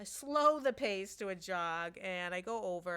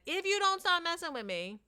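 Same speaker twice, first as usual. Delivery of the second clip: high, louder threat; mock warning; 9 semitones higher, 6 dB louder than usual. Daughter threatening the boy, loud warning. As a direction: warn him off sharply. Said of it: "and said".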